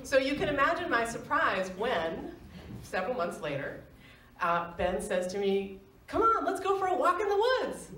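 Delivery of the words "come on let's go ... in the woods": bright coaxing, much higher; eager coaxing. Retold or imitated. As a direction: lure her out with boyish eagerness. Boyfriend coaxing her outside.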